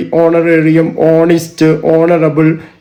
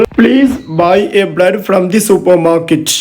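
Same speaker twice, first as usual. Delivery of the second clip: very slow, clear reading of the mistake; slow wrong example; much slower than usual. Shown as a demonstration. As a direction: read the wrong sentence slowly for the class. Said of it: "അത് തെറ്റാണ്". (പതുക്കെ, തെറ്റായ വാക്യം)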